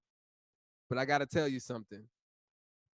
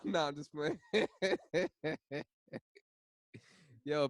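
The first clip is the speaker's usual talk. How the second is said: usual speaking voice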